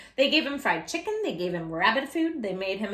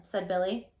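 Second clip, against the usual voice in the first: usual speaking voice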